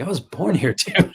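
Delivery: as if it's obvious; incredulous retort. Retold or imitated. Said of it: "I was like".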